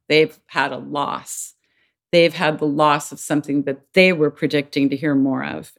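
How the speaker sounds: ordinary delivery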